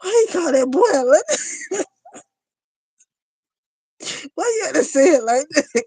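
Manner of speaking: high, tearful cackling speech; laughing to tears. Real, not acted. Host asking why you had to say it, laughing to tears. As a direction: wail it out, helpless with laughter. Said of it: "I'm over here in tears".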